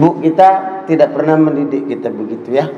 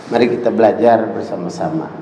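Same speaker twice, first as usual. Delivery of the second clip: ordinary delivery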